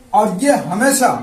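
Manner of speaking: ordinary delivery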